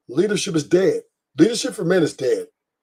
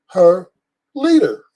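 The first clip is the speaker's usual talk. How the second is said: ordinary delivery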